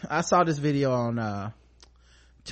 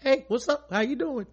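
mock friendly greeting; 10 semitones higher than usual. Mimicking the boyfriend's words.

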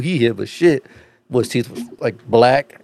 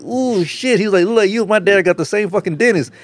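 high-pitched mock-astonished stranger; imitated amazement; 9 semitones higher than usual. Stranger's amazed reaction acted out.

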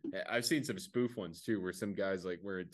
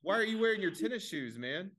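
higher, louder mock challenge; mocking questioner; 10 semitones higher, 3 dB louder than usual. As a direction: needle him about the shoes, pitched up.

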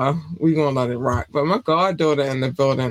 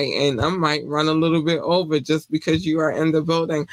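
ordinary delivery

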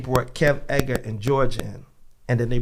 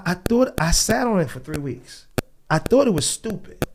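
ordinary talk

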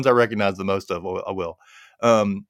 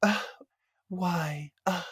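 a hint of a whiny, hesitant mom; mock the mother's whiny stalling; whiny mom impression; 7 semitones higher, 8 dB quieter than usual. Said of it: "She's kinda whiny and kind of, like, breathy".

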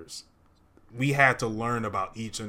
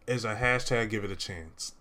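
ordinary talk

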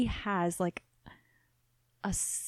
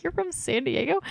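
incredulous question; 7 semitones higher than usual. Retold or imitated. Retold disbelief at Kai.

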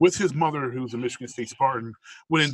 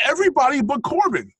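emphatic; 14 semitones higher, 8 dB louder than usual. Real, not acted. Emphatic opinion on wrestlers.

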